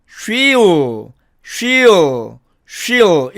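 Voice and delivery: "she'll" as "shi-o", L left open; contracted she'll. Shown as a demonstration. Teacher modelling she will shortened, contracted. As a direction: glide "she'll" into "shi-u", no closed L. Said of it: "이렇게 발음합니다".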